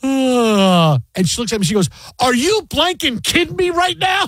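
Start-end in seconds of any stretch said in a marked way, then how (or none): 0.0-1.0 acted yawn. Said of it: "and I yawn". 2.2-4.2 high-pitched outraged shriek, acted out; mock screaming wife. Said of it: "she's just screaming".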